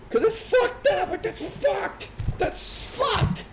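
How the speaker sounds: very high, strained falsetto; exasperated squeal. Acted. Exasperated climax of rant.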